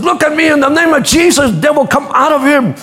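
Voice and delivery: commanding exorcist. Retold or imitated. Friend casting out a devil.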